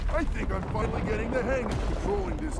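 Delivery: loud, high whoop of joy; booming delight; excited cheer. Acted. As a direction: shout it out thrilled at the controls. Daruk cheering while piloting beast.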